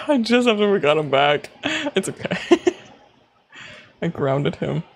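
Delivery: ordinary delivery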